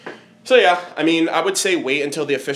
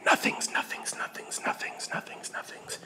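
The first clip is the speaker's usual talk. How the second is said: fading breathy whisper, softer each time; 12 dB quieter than usual. Pretend. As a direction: whisper it away into nothing. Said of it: "Whisper sweet nothings to us".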